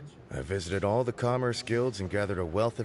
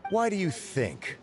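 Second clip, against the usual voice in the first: higher, slow teasing comeback; smug retort; 10 semitones higher, slower than usual. Acted. Xianghua teasing her rival who asked why she's here, smug and slow. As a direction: lord it over the rival.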